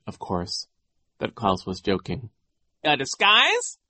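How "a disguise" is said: high, loud questioning echo; put-on high voice catching the joke; Coach Genghis. A narrator's reading. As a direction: repeat it as a rising question. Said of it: "His fierce look melted away".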